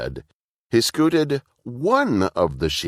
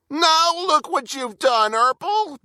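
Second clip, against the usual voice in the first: high, wailing accusation; high howling Burple; howling little brother. A narrator's reading. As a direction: howl the blame like an upset kid. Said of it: "Burple howled".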